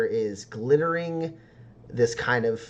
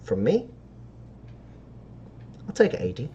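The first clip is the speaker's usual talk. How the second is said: halting sales pitch with long pauses; sly dealer's pitch; 4 dB quieter than usual. Acted. Dealer pricing rare stardust for a customer, lightly voiced sly pitch. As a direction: draw out the pitch before naming the price.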